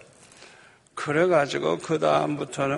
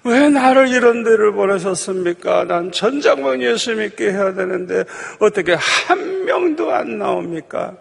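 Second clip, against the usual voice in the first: raised, pleading re-enacted cry to God; mock tearful prayer; 6 semitones higher than usual. Chaplain praying to Father over empty pews, imitated tearful pleading. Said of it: "엎드려서 울면서 기도하죠".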